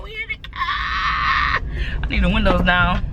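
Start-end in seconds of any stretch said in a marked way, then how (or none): 0.0-1.6 high slow sing-song call; drawn-out shout. Real, not acted. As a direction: stretch the shout out slowly. Repeating the announcement.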